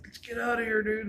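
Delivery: a startled retold plea to leave; spooked urge to flee. Scared teen to stoned friend, urgent high plea to leave. Something runs across, fleeing.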